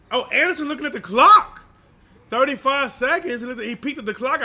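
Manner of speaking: live play-by-play. Acted.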